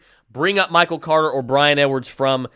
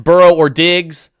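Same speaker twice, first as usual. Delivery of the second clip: ordinary talk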